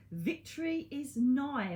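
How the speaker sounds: mock-regal victory cry; triumphant proclamation. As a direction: proclaim it like a herald. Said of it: "This is a victory card".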